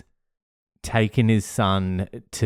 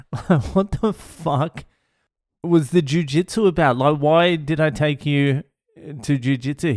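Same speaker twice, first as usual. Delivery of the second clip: high-pitched incredulous dad rant; retold yelling; 6 semitones higher than usual. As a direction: demand answers in exasperation. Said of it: "and I'm yelling".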